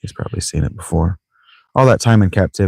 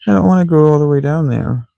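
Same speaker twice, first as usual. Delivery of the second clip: higher-pitched whine, sliding down; moaning complaint; 9 semitones higher than usual. Real, not acted. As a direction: grumble reluctantly about the trek. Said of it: "My pissing and moaning".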